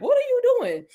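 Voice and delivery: high, surprised asking; high startled question. Retold or imitated. Finding him waiting on the porch, a high startled question. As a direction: pitch it up like you've been caught off guard.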